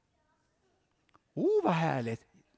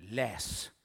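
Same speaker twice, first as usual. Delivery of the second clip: whispered command; 6 semitones lower than usual. Acted. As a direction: breathe "läs." as a hushed order. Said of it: "säger Gud".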